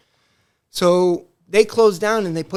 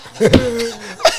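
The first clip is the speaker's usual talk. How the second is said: real laugh; 3 semitones higher, 6 dB louder than usual. Real, not acted. Laughing while retelling the story.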